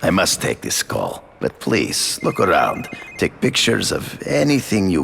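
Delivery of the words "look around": normal talking voice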